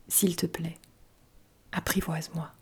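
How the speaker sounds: very soft, hushed pleading; quiet plea. A narrator's reading. Fox begs the little prince to tame him, hushed plea. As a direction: ask gently, almost under your breath.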